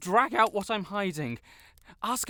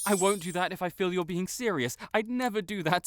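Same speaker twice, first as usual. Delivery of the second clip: usual speaking voice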